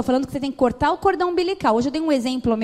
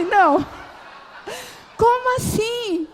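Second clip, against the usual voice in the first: indignant protest; 10 semitones higher, slower than usual. Retold or imitated. Wife protesting to her husband about the game, high outraged cry. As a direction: wail it in disbelief, pitched high.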